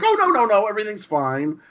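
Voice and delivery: high-pitched mock reassurance, settling down at the end; reassuring scientist. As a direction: imitate someone hastily brushing off a worry. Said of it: "One of them's uneasy. The other one's like".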